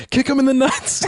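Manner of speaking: high, loud movie-line yell; hammed-up urgent holler; imitated shout. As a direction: shout it out high, like a kid egging on a fight. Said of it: "one of them just shouts".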